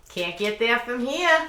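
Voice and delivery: imitated Down East Maine accent. Locals answering lost summer visitors, Maine accent. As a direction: stretch the vowels like a Mainer, no Rs. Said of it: "I spent summers in Maine".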